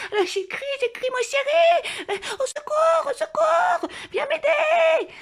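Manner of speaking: high, loud shrieking cry, stretched out; panicked scream for help. Retold or imitated. Calling her darling to come help, shrieking in mock terror.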